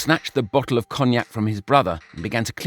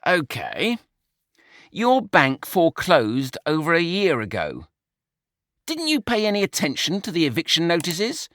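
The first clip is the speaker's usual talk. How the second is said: higher, impatient disbelief; exasperated Brian; 6 semitones higher than usual. A narrator's reading. Brian reading the foreclosure to George, exasperated. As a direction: needle a careless brother.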